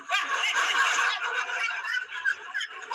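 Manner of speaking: long high-pitched burst of laughter; real helpless cackle; big laugh. Real, not acted. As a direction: burst out laughing and keep it going. Amused by retold court story.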